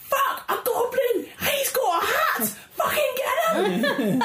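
high panicked shout at a goblin; panicked guards yelling. Retold or imitated. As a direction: yell it like startled guards spotting a goblin. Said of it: "so they're like".

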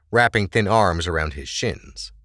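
normal talking voice

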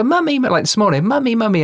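put-on high-pitched little girl's call; child calling mummy. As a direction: cry out for mummy like a toddler. Daughter calling for mum this morning.

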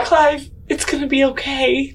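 high-pitched coo through a laugh; mock cooing. Player strokes distressed Clive with trunk, high mock comforting. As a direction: soothe him in a laughing, high voice.

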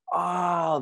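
long drawn-out high pained oh; pained groan. Retold or imitated. Surfer on the stretcher reliving the pain, pained oh. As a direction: let out a slow high cry of pain. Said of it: "screaming in between".

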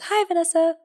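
high, playful sing-out greeting; high-pitched greeting. Pretend. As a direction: call hi sweetly, way up high. Greets listener in a squeal.

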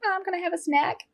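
put-on high, casual kid voice; a lightly voiced child asking for a snack; little boy. Son wandering in for a snack, high kid voice. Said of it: "when he's just like".

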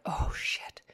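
a whispered oh-no; mock dog dreading being carried in. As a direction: breathe it out like a caught dog. Dog realises he's caught.

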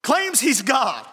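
high, driving sermon voice, rising to a shout; fervent preaching. Acted. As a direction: preach it to the congregation with fire. Preaching at full force.

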